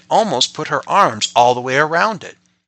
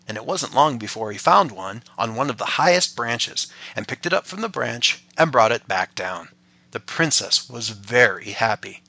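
ordinary delivery

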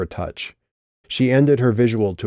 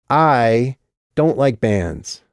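put-on slow drunk insistence; 4 semitones higher, 4 dB louder, much slower than usual.

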